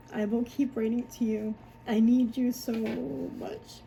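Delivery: emotional letter reading, drawing out each "so much"; a heartfelt letter read aloud in short, separate phrases; reading child's letter aloud. Acted. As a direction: read it out line by line, pausing between sentences. Reads her child's letter aloud.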